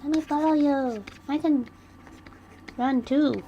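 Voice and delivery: high and loud begging; pleading Nagisa. Acted. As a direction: whine it like a clingy kid. Voicing Nagisa pestering Rei.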